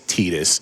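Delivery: "Titus" said TEE-dus; long E in the first syllable of "Titus"; TEE-dus pronunciation. Shown as a demonstration. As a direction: answer "Titus" starting like "tee". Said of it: "What do you say".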